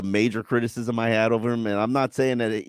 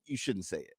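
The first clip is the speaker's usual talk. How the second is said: usual speaking voice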